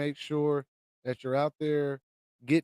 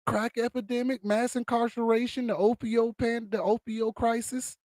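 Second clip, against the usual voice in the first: heated listing; 5 semitones higher than usual. Real, not acted. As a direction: fire off grievances one by one. Listing grievances, raised voice.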